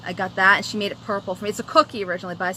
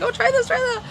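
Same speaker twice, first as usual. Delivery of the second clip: excited; 4 dB louder than usual. Retold or imitated. Retold helping her friend.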